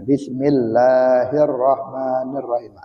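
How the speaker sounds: slow, measured recitation; Quran recitation. Shown as a demonstration. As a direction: draw out the long vowels as in recitation. Recites the basmalah as example. (lantunan bacaan basmalah yang pelan)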